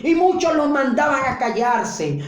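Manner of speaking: normal talking voice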